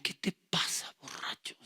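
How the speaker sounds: put-on hissed threat to the drunk son; menacing whisper. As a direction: say it low and unvoiced, full of menace. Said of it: "Y me dice".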